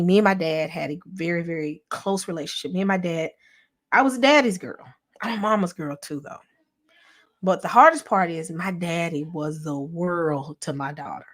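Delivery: ordinary talk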